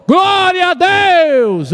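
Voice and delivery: drawn-out triumphant shout; Jericho battle shout. Acted. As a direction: let out the great victory cry.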